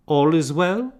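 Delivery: put-on high little girl asking; small child's question; lightly voiced girl. A narrator's reading.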